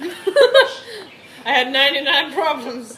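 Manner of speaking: slow, loud laughing delivery; slowed quote. Acted. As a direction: stretch it out for the joke. Quoting a rap line, slowed.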